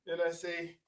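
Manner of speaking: ordinary delivery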